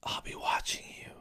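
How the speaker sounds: creepy spoken lyrics. Shown as a demonstration. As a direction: say it like a stalker, dropping to a whisper. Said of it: "you just say them, you don't sing it".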